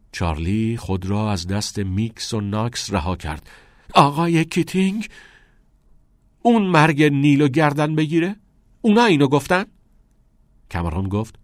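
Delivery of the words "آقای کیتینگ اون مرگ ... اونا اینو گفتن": loud, high disbelieving questions; shocked, raised-voice challenge; incredulous. A narrator's reading.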